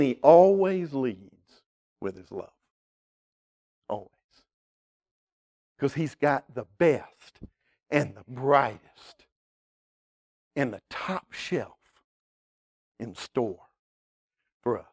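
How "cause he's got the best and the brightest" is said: ordinary talk, nothing marked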